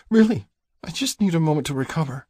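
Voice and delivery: high woman's voice, a touch choked. A narrator's reading. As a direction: reassure him in a high, strained voice. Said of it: "she chokes out".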